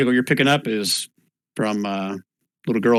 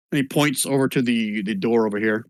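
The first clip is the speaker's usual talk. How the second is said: ordinary talk, nothing marked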